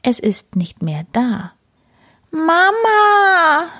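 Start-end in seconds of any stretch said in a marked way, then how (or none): 2.3-3.7 high, loud mewing call for mother; loud kitten meow. A narrator's reading. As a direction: cry out like a lost kitten. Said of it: "miaut Karlchen laut". (lautes, hohes Kätzchen-Miauen)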